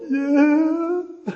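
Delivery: high, drawn-out tearful whine; mock weepy. Overwhelmed fan answering the woman at the stadium, mock weepy. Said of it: "I can't handle it".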